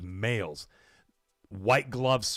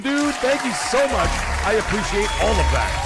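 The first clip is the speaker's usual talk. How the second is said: hype announcer; 13 semitones higher, 7 dB louder than usual. Acted. Hyping a donation from Qatar.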